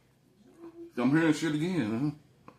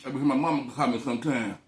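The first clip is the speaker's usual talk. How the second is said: usual speaking voice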